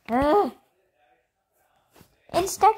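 normal talking voice

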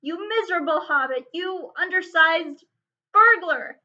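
loud insults, building to an explosion; furious shout. A narrator's reading. Dwarf king shakes the hobbit, shouting at a loss for words. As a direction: sputter in rage, groping for words. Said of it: "he shouted at a loss for words".

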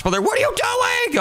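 high startled yell at the brother; shocked shout. Real, not acted. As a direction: yell at him in disbelief. Finds brother made a mess.

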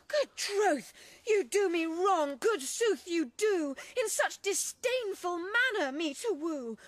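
ordinary delivery

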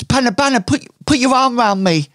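high, fast gushing plea; high fangirl voice; gushing fan. Acted. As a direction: beg your idol for a pose. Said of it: "she says".

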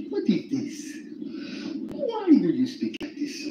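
high, slow mock African voice; imitating an African asking, drawn out; African accent. Mocking an African friend's question, African accent. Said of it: "You gotta be an African".

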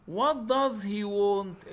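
very slow, clear read-out of the question. Shown as a demonstration. Teacher reading the question slowly to the class. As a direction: spell it out slowly for pupils.

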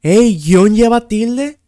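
loud, high, drawn-out challenge; indignant disbelief. Real, not acted. Outraged at the quiz's answer. (incredulidad indignada, fuerte y aguda)

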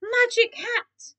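high, slow, proud announcement; sudden inspiration. A narrator's reading. Keith announcing his excuse to the cats, inspired. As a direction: draw it out as a bright idea.

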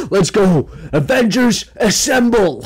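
an over-the-top Avengers battle cry; mock hero rally cry. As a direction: shout it like a cheesy superhero leader.

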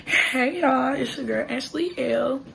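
ordinary delivery

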